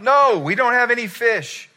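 loud, high-pitched exasperated shout; frustrated fishermen yelling across the water; frustrated call-out. Acted. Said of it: "frustrated after a whole night of fishing, they call out".

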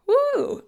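a high, shaky release of breath; real emotional woo, caught off guard; emotional exhale. Real, not acted. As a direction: let the feeling out in one high woo. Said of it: "oh my gosh, just even thinking about my daughter".

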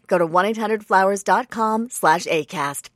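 usual speaking voice

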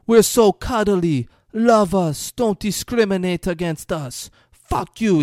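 high, loud, hammed-up ranting; mock furious rant. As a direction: rant like he's jabbing a finger at him. Retelling a furious rant scene.

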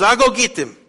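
loud, high put-on foreign accent; Speedo man's accent. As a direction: offer help in broken English, booming. Speedo man leaving.

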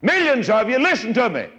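high-pitched holler to a vast crowd; hollering for attention. Retold or imitated. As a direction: call out over millions, imitating Moses. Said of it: "he hollered at 'em and got their attention".

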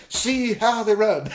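laughing. Real, not acted. Gleeful reading of scripture.

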